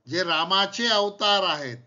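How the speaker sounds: normal talking voice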